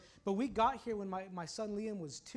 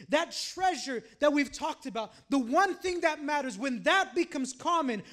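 raised preacher's voice, growing louder and higher; forceful preaching; 7 semitones higher, 13 dB louder than usual. Real, not acted. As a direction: build the warning with mounting force.

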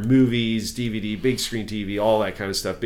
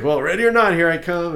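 higher-pitched mock announcement; lightly acted ready-or-not call; playful calling out; 6 semitones higher than usual. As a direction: call it like a hide-and-seek seeker. Retelling his entrance in costume.

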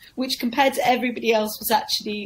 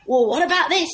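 pitched-up pleading comic voice; desperate comic; 7 semitones higher than usual. Retold or imitated. Comic losing the crowd asks if they like this, pleading. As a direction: play the failing comic, higher and laughing. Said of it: "so he said".

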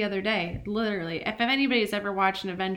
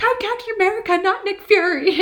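put-on high, laughing kid protest; indignant kid, laughing; 11 semitones higher, 8 dB louder than usual. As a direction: play the offended boy, laughing. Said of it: "he was like".

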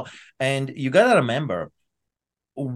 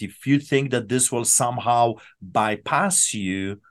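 normal talking voice

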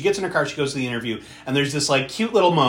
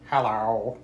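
Squidward voice; 2 semitones lower than usual. Retold or imitated. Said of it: "in a Squidward voice".

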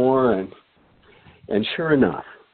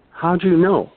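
higher and louder, amazed; surprised; 8 semitones higher, 3 dB louder than usual. Retold or imitated. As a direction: pop up high in surprise. Joe amazed at the guess.

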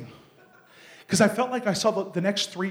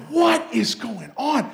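stunned question; 5 semitones higher, much slower than usual. Retold or imitated. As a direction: drag it out in shock at the sight. Stunned at friend's swollen head.

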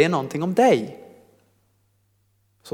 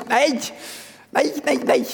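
much higher, quick repeated denial; high-pitched refusal; 11 semitones higher than usual. Acted. Preacher refuting the fearful belief, high-pitched protest. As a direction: wave it away in a high voice.

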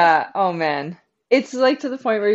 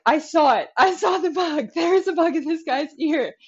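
convinced crew; 8 semitones higher than usual. Retold or imitated. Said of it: "my crew is like".

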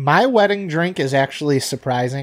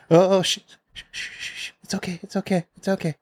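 soothing shush; 5 semitones higher, 3 dB quieter than usual. Retold or imitated. Shushing baby asleep on chest.

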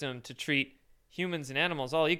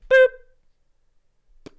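high, loud button boop; 14 dB louder than usual. Pretend. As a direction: squeak a cartoon boop. Imitates hitting red button.

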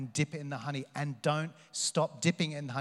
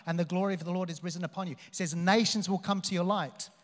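ordinary talk, nothing marked

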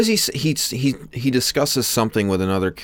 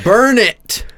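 shout; 10 semitones higher, 6 dB louder than usual. Real, not acted. Reacting to Bloomberg text.

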